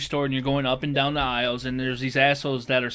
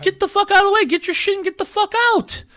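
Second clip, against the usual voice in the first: much higher, louder mock shout; angry yell; 4 dB louder than usual. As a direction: blow up at the shoppers in a high yell. Ranting at aisle blockers.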